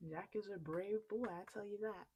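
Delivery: plain reading voice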